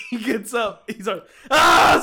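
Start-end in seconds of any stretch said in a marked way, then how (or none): 1.5-2.0 sudden high, loud mock scream; imitated scream. Host acting out the next lifter, screaming. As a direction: burst into a put-on gym scream. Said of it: "Starts screaming too".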